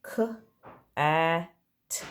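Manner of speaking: each letter sound said separately, with pauses between. Shown as a demonstration. Teacher to pupils, sounding out a word letter by letter. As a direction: split the word into its single sounds for the class. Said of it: "you should be saying".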